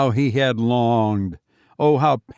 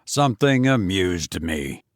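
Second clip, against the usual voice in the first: Fenwick curt; 4 semitones lower than usual. Acted. Fenwick deflects.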